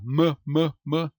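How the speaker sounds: "m, m, m" como mâ, mâ, mâ isolados; som de consoante. Shown as a demonstration. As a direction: solte "m, m, m" em sílabas curtas. ("m, m, m" dito mâ, em golpes separados)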